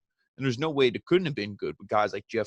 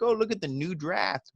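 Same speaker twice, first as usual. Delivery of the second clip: high cheesy showing-off voice; mock WWE hype; 7 semitones higher than usual. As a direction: parody a proud announcement. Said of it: "be like".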